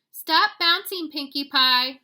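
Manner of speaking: slow, high, loud mother's scold. A narrator's reading. Mama scolding her bouncing kitten, slow and loud. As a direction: scold like a mom at bedtime. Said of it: "scolded Mama".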